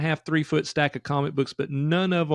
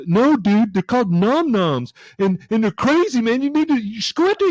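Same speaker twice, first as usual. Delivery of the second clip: lightly voiced eager fan, higher and louder; a stranger gushing about the show; excited fan; 6 semitones higher, 5 dB louder than usual. Fan at concession stand raving about episode, excited. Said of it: "He goes".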